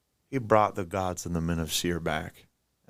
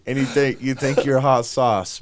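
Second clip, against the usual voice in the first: mock taunt; 4 semitones higher, 7 dB louder than usual. Mock taunting the boastful king.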